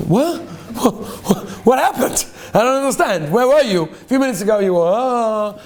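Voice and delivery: high, puzzled mock confusion; high bewildered "what happened?"; bewildered other davener. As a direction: act out the baffled guy who lost track. Confused man in the quote.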